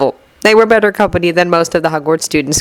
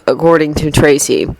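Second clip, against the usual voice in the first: ordinary delivery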